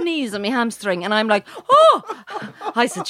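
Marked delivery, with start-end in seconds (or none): 1.7-2.0 sudden high, loud "oh"; pained yelp. Retold or imitated. Client under masseuse's knees, re-enacted loud high yelp. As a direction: yelp as if knees dug into you. Retold yelp during painful massage.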